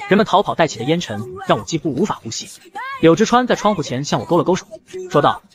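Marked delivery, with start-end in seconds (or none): none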